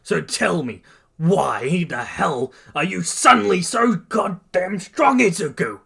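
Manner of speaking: resentful tirade building to a shout; scoffing rant. A narrator's reading. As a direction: let bitterness build into a loud demand. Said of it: "Bakugo scoffs and says".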